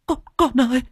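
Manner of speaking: high, desperate wailing cry; panicked wail. Acted. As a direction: plead in terror for the baby. Mẹ thấy con không thở. (vợ hoảng loạn kêu chồng, giọng gào cao)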